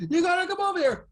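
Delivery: high, loud imitation of a fight-scene scream; hammed-up action-movie scream; screaming movie character. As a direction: yell it like someone screaming mid-battle. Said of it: "they're, like, fighting and screaming".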